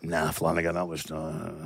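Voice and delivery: low, quiet put-on voice of a colleague; imitating Booney. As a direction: mumble it low like a dismissive colleague. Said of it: "Booney would be like".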